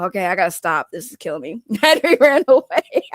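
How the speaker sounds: ordinary talk, nothing marked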